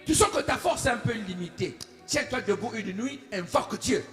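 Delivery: usual speaking voice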